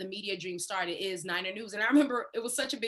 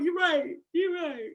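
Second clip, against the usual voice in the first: conceding; 6 semitones higher, 3 dB louder, slower than usual. Retold or imitated. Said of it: "I'll be like".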